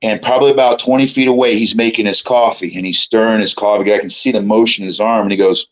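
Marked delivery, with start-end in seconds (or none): none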